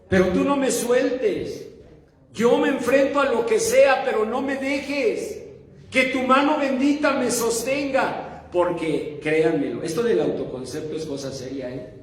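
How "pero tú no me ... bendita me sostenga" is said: higher-pitched, insistent prayerful appeal; raised voice, imploring; earnest plea. Acted. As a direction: plead with God to hold on. Acted plea to the Lord.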